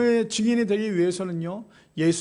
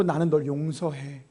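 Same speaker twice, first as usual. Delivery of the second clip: tender forgiveness; 5 semitones lower, 5 dB quieter than usual. Acted. Jesus voiced forgiving Peter.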